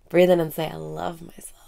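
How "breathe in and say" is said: guided meditation. Acted. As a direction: guide the listener inward, half whispering. Guided self-love meditation.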